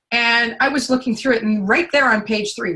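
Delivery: usual speaking voice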